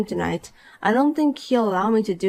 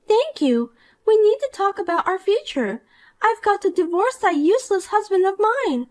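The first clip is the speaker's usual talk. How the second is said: put-on higher, smug and gleeful young woman; Poppy, gloating; 9 semitones higher than usual. Poppy gloats to the wife about meeting Edward, higher and smug. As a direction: crow over your win like a spoiled brat.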